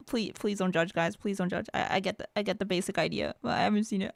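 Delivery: soft, embarrassed plea; sheepish pleading. Real, not acted. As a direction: beg them quietly not to judge. Pleading not to be judged.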